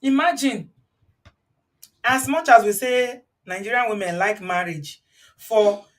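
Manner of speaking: ordinary talk, nothing marked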